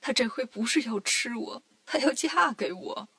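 hammed-up tearful monk; tearful whine. A narrator's reading. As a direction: wail it mildly, dragging out the words. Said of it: "唐僧哭了".

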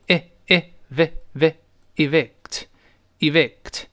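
evict split into its sounds very slowly; syllable-by-syllable demo. Shown as a demonstration. Teacher sounding out evict for students. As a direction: break evict apart slowly. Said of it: "注意这个发音".

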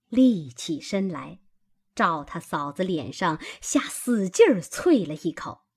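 ordinary delivery, nothing marked

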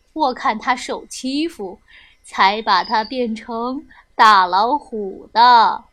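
put-on low old man's voice, reassuring; amused low-pitched old wizard; kindly old grandpa, amused.

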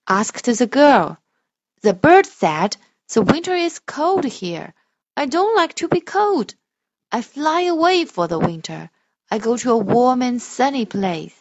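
loud, slow storybook voice; reading to children. Shown as a demonstration. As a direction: read it slowly and grandly for a child. Parent models expressive reading.

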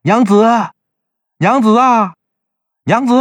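urgent high-pitched call for his wife; husband calling through the house. A narrator's reading. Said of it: "他赶紧就喊".

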